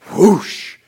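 loud whooshing rush of fire; loud imitated fire whoosh; whoosh sound. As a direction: burst out a fiery whoosh. Fire falls from heaven.

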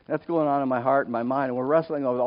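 ordinary delivery

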